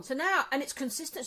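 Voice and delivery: ordinary talk, nothing marked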